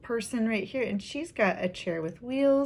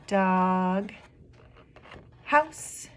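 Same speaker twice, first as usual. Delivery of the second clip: syllable by syllable. Shown as a demonstration. Teacher showing two syllables in a word, broken apart. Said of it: "there's two syllables in that word".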